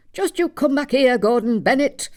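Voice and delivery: mother yelling after a runaway son; retold shout. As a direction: call the boy back across the fairground. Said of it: "shout at the top of your voice".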